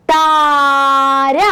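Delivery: താര stretched, long taa; slow drawn-out word. Shown as a demonstration. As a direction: draw out താര for the class. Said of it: "തായും രായും ചേർത്തൊരു".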